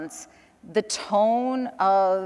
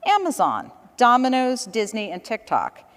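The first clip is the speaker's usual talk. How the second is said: usual speaking voice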